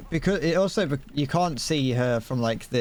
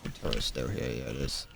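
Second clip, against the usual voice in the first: muttered aside; 11 semitones lower, 9 dB quieter than usual. Real, not acted. Muttering to self mid game.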